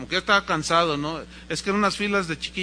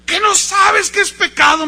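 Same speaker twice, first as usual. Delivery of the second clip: high, full shout; acted outraged dad; angry father; 13 semitones higher, 12 dB louder than usual. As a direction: scold the boy at the top of your voice. Angry father scolding son.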